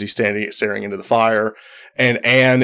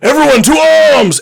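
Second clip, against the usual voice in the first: much higher, loud rallying shout; battle cry; 6 dB louder, faster than usual. Acted. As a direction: call the whole camp to war. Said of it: "he goes".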